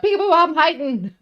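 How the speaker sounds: high, loud sing-song hiding call; playful peekaboo. Acted. Hiding creature teasing the players, loud and playful. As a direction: chirp it out like a child hiding.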